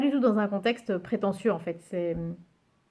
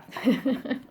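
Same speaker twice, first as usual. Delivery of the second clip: short laugh; 4 semitones higher than usual. Real, not acted. Laughs at the English name.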